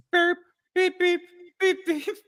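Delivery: high beeping like a truck backing up; reversing truck beep. Retold or imitated. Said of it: "he kinda gets a reverse c".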